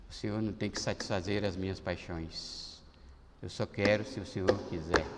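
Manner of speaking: soft, low, humble prayer to God; quiet prayer. Retold or imitated. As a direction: pray it quietly, surrendered. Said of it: "esses dias eu tenho orado assim".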